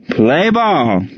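high, drawn-out game call; umpire's yell. A narrator's reading. Umpire starting the game for the crowd, yelled. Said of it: "yelled the umpire".

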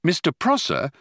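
ordinary delivery

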